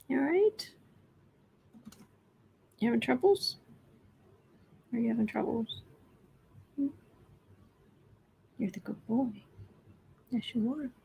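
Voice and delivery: pet talk. Real, not acted. Talking to the kitten.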